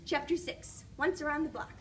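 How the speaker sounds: ordinary delivery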